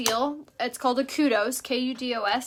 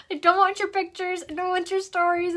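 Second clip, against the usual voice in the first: high, loud panicky protest; freaked-out refusal; 8 semitones higher, 5 dB louder than usual. Retold or imitated. Vlogger refusing followers' snake stories, high panicky voice. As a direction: push the stories away in alarm. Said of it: "I'm like".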